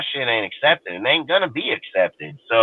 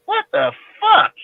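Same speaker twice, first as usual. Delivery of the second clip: baffled outburst. Real, not acted.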